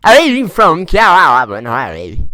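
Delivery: exaggerated Cavan lad accent, drawn out loud; hammed-up Cavan accent. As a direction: lay the Cavan accent on thick, dragging every vowel. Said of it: "the way the lads from there talk".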